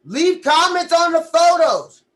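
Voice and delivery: slow, loud and high; shouted promo. Real, not acted. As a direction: hype the viewers up. Promo call-out.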